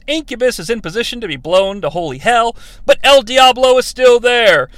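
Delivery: usual speaking voice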